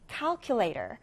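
"calculator" said as CAL-culator, first syllable strongest; stress on the first syllable. Shown as a demonstration. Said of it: "첫 음절의 강세를 두고".

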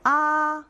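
아 held high and level; first tone. Shown as a demonstration. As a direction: sustain 아 on one high, even note. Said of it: "일, 이, 삼, 사성을 연달아".

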